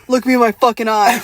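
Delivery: retold laughing shout; mock menacing demand. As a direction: bark it with a grin. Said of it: "I would turn around and be like".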